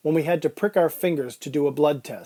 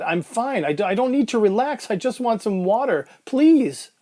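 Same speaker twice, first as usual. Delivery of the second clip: high, agitated, hurried pleading; desperate patient begging in a raised pitch; pleading protest; 7 semitones higher than usual. Acted. Anxious patient protests, pleads for water.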